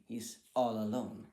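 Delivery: ordinary talk, nothing marked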